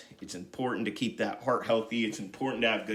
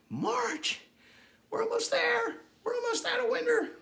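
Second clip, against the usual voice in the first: much higher, excited squeal; squeaky excited voice. Real, not acted. Instructor cheering end of winter in a high squeal. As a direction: squeal it like a giddy cartoon.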